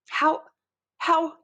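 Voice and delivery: can't believe your eyes; stammered amazement; astonished. A narrator's reading. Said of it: "I ask".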